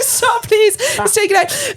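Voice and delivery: high, hammed-up begging; panicked plea. Patient wanting the doctor to stop, panicked plea. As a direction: plead in a squeaky mock panic.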